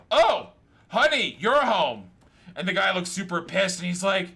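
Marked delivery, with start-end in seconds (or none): 0.1-2.1 higher, louder, slow and sugary; fake-sweet greeting from the ad; caught-out girlfriend. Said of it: "girl in the bedroom going".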